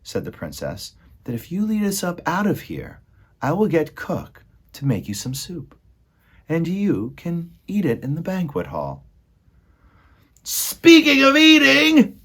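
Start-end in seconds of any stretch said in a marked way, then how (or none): 1.3-9.1 high-pitched princess voice; earnest princess. A narrator's reading. Princess promises soup to the rat. 10.4-12.2 high, loud, drawn-out yell; rat shouting. A narrator's reading. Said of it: "shouted one of the rats".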